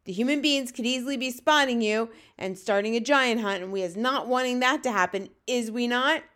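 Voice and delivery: loud, domineering giant; Fleshlumpeater, bullying. A narrator's reading. Bully giant demanding answers from the runty one, booming.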